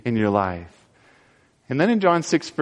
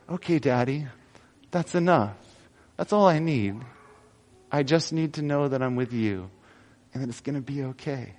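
hushed, fading to near silence; a small girl settling into her father's arms; soft childlike trust; 2 semitones lower, 9 dB quieter than usual. Pretend. Little girl telling Daddy she trusts him, hushed and fading. Daughter voiced in a story.

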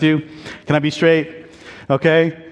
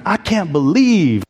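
slow, higher-pitched Southern drawl; Texas drawl; 4 semitones higher, slower than usual. Retold or imitated. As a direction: imitate an upset Texan, stretching the words in a Southern drawl. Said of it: "you know, the Texas drawl, the Southern drawl".

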